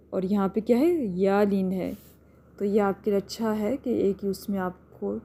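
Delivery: ordinary talk, nothing marked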